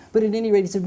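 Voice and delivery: usual speaking voice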